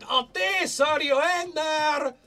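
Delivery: high, loud yell through a door; full-voiced, high-pitched holler; screamed call. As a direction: scream out for someone inside. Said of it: "[name] just screamed".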